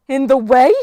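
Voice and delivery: loud, high, indignant echo; a goddess bristling at a slight; offended outrage. Acted. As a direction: throw his words back in disbelief. Goddess insulted by the giant.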